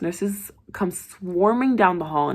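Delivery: plain reading voice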